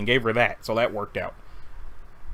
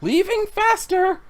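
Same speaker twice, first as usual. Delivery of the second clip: high, slow retreat; 12 semitones higher, slower than usual. Acted. Character retreats.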